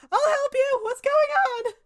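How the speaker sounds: very high, loud, eager voice; eager villager. Acted. The pious villager runs up calling out to help.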